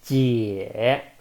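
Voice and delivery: 姐 dipping low then rising; third tone. Shown as a demonstration. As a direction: let 姐 sink and come back up. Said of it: "down, up".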